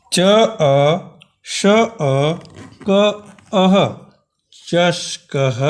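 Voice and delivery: slow, syllable by syllable joining of sounds into चषकः; teacher blending letters slowly into चषकः; sounding out letters. Shown as a demonstration. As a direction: spell out च अ श अ क अह, then blend into चषकः. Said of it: "जैसे उदाहरण के लिए यहाँ दिखाया गया है".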